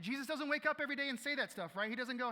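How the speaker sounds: fast, loud emphatic preaching. Real, not acted. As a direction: push it out with preacher force. Preacher building emphatic point.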